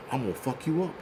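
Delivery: quiet, low mock tough-guy threat; low menacing threat. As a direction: mutter a fake threat. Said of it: "They're like".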